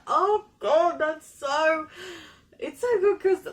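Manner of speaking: high, loud gushing; fangirl squealing. Real, not acted. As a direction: gush like a thrilled fan. Gushing over the song line.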